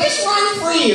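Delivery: high singing-out mother imitation; airy high mock-mom voice; mock carefree mother. Imitates mother letting kids loose.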